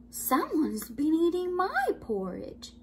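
a hint of a put-out mother bear; medium-sized voice. A narrator's reading. Mama Bear finds her porridge eaten, lightly voiced medium-sized voice. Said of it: "in her medium-sized voice".

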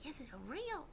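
put-on high, wondering child voice; lightly voiced puzzled child; little kids asking. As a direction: play a curious little kid. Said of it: "They were like".

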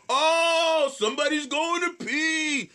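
loud, high drawn-out announcement; mocking call-out. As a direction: call someone out across a room, high and stretched. Loud bathroom call-out from stage.

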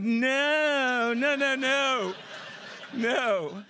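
put-on half-laughing kid begging him not to come in; panicked refusal. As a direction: wave him off fast and laughing. Refusing rescuer talking to parents.

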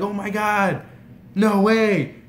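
high, loud disbelief; amazed. Retold or imitated. Fan learning the star is from Texas, amazed. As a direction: exclaim it, starstruck.

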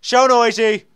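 loud, high exclamations; real noisy outburst mid-game. Real, not acted. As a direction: shout it out noisily in bursts. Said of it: "I'm so loud".

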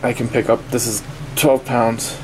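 ordinary talk, nothing marked

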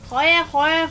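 sharp, high burst of swearing; loud cursing. Real, not acted. Muere en el juego. (maldiciendo a gritos)

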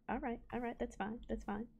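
soft, deflated self-soothing; resigned. Real, not acted. Player calming down after cows escape, resigned. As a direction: talk yourself down quietly.

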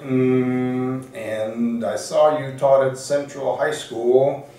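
high, loud mimicry with drawn-out hums; Mike Jacobstein. Mentor explains his disappointment to applicant, high loud mimicry. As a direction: imitate the mentor, stretching it out to the punchline. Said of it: "he speaks like that".

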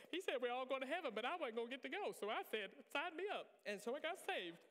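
quick, high-pitched comic convert voice; donkey-like character voice. Acted. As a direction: play it as an eager comic sidekick. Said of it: "when I do that voice".

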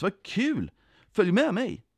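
high, swooping delight; cheerful invitation. A narrator's reading. Jesus säger följ med mig, cheerful. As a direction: invite them along happily.

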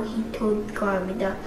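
ordinary delivery, nothing marked

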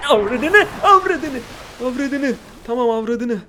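very high, loud panicked cursing; frightened yelling curse. Real, not acted. Player scared by the leaping eel, cursing in a high loud yell. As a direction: shriek the curse as it leaps at you. Eel jumps at him suddenly.

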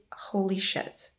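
slow whispered shock; drawn-out, hushed disbelief; stunned whisper. Retold or imitated. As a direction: imitate someone stunned, all breath and no voice. Boyfriend's stunned reaction, retold.

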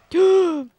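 loud, high gasp; startled gasp. Real, not acted. Player surprised by a mammoth, startled gasp. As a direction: gasp sharply in sudden shock.

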